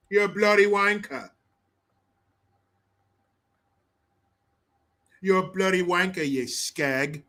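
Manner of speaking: playful British street insult; hammed-up British accent, trying out the slang; mock British accent. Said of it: "I'm up on my English shit".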